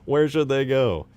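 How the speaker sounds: hammed-up officer voice; mock general. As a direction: boom it out like a general. Reading game dialogue aloud.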